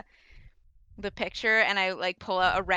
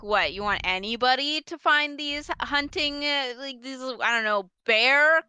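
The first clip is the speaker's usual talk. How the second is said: mock incredulous; 4 semitones higher, 4 dB louder than usual. Shopkeeper mocking customer's question.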